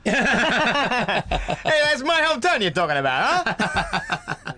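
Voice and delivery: high-pitched hammed-up indignation with laughter; jokingly affronted, squeaky-high and laughing; mock offence. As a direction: play the insulted local, voice pushed way up. Mock-offended replay of hometown reply.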